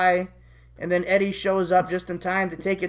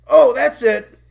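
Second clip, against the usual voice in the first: loud, higher burst of exasperation; frustrated outburst; 6 semitones higher, 11 dB louder, slower than usual. Acted. Eddie fed up with his friends' replies, frustrated outburst. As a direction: explode in acted frustration. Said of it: "Eddie gets frustrated".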